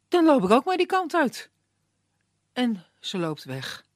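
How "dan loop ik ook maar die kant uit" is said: higher and louder, resolute; she's decided, off she goes; determined girl. A narrator's reading. Anna tells Puck she's going too, higher and resolute.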